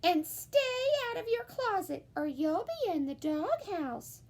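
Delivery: lightly voiced sisters adding a threat; sisters' warning. A narrator's reading. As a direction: warn him like a bossy sister. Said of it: "her sisters added".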